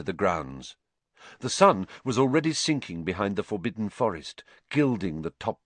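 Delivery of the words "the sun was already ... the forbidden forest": ordinary delivery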